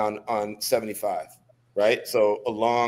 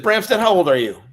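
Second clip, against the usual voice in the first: higher, louder barked question; sudden loud question; 8 semitones higher, 6 dB louder than usual. Retold or imitated. Instructor challenging a lagging recruit, loud. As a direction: snap the question out at him.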